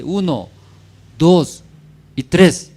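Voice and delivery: loud count-in for reading together. Real, not acted. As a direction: call out the count to the whole congregation. Counts congregation in to read together. (conteo en voz alta para leer juntos)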